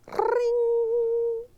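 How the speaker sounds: high sustained ring; high held ringing sound; phone ring. A narrator's reading.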